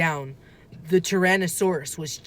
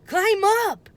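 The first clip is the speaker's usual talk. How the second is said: urgent call; 13 semitones higher, 3 dB louder than usual. A narrator's reading. Annie urging Jack.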